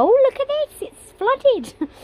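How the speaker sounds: imitating an upbeat vlogger; mock chirpy vlogger. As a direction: squeal it like a giddy vlogger showing off. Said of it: "getting my camera out and go".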